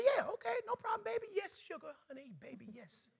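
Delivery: high, fading sugary yes-dear voice; sweet wife voice. Retold or imitated. Husband imitating his wife, high sweet and fading. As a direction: coo it up high like a compliant wife softening away.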